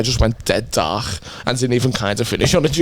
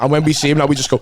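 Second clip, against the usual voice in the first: ordinary talk, nothing marked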